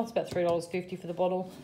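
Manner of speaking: ordinary talk, nothing marked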